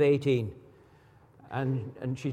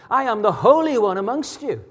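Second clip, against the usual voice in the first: higher, emphatic proclamation as God; God declaring; 4 semitones higher than usual. Retold or imitated. Preacher voicing the Holy One rebuking sin, higher and emphatic. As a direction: preach it with swooping, weighty stresses. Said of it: "he says".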